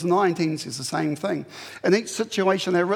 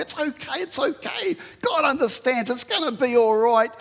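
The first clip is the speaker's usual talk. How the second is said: high, sing-song mock reassurance, much higher; mocking soothing tempter; 7 semitones higher, 3 dB louder than usual. Preacher mimicking people who excuse sin, high mock-soothing voice. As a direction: coo it like a falsely comforting voice, hammed-up. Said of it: "there are always gonna be people that say".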